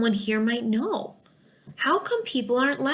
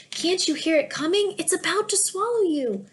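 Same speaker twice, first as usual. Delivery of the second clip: excited; 7 semitones higher than usual. Acted. Laughter spreading, excited jester.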